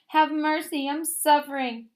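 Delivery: high, loud, tearful begging; a suffering fox begs the dentist with high tearful cries; weeping plea. A narrator's reading. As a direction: sob out a plea for mercy. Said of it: "he wept so bitterly".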